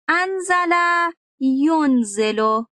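انزل high, then ینزل drawn out very slowly as yonzelo; careful pronunciation. Shown as a demonstration. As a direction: spell out ینزل syllable by syllable, slowly. (تلفظ شمرده و خیلی آهسته)

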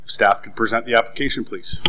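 ordinary talk, nothing marked

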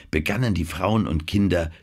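plain reading voice

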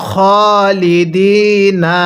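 slow, drawn-out recitation of খালিদিনা with long vowels; Quran recitation. Shown as a demonstration. Teacher modelling খালিদিনা for viewers to repeat, slow recitation. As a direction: recite খালিদিনা slowly, stretching each vowel. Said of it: "পড়ুন".

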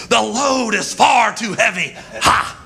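shouted preaching with punched "Ha!" breaths; hammed-up revival preacher cadence; Pentecostal preacher. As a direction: preach it hard, punctuating with "Ha!". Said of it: "like a Pentecostal preacher".

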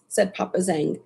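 ordinary delivery

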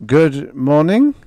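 slow, unsure greeting; hesitant. A narrator's reading. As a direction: say it haltingly, unsure. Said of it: "addressed the girl hesitantly".

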